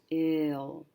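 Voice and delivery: ordinary delivery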